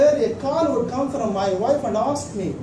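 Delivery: normal talking voice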